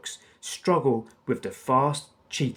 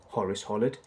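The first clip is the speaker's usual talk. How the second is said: ordinary delivery, nothing marked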